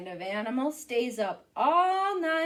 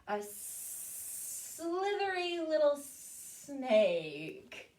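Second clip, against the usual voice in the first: lightly voiced snake; 3 dB quieter, much slower than usual. Snake speaks in the guessing game.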